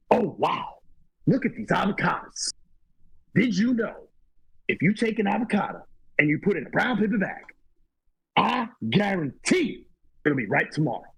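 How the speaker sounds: high-pitched impression with big pauses; exaggerated, halting movie-star delivery; Al Pacino. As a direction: act amazed, breaking the lines up dramatically. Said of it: "I met Al Pacino years ago".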